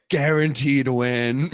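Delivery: slow, drawn-out confident guarantee; Jerry's boast. Retold or imitated. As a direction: stretch each word out like a bold promise. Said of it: "Jerry is like".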